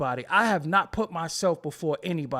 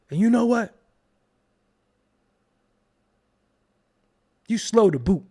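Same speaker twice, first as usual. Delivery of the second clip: mock comeback; 3 semitones higher, 5 dB louder than usual.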